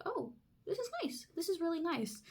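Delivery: soft, high, relieved sigh of pleasure. Retold or imitated. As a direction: breathe out in relief. Relief at mom's calm reaction.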